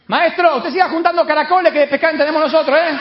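high, loud exasperated retort; sarcastic shouted brush-off; fed-up shouting back. Retold or imitated. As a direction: yell back at a pest on the shore. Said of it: "Entonces Juan se cansa".

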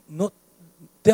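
ordinary talk, nothing marked

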